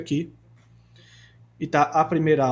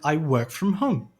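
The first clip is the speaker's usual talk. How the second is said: I work from home in a light British accent, R dropped; slower than usual. Shown as a demonstration. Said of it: "essa palavra você pode falar com sotaque britânico".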